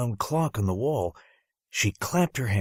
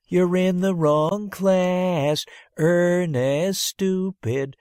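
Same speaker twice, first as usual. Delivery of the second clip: much higher, louder, very slow sing-song taunt; taunting kids; 9 semitones higher, 5 dB louder, much slower than usual. Acted. Classmates jeering at the boy in the wrong class, sing-song taunt. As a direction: jeer at him like a mocking kid.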